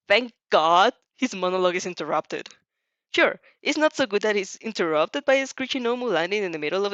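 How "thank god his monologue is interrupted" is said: ordinary delivery, nothing marked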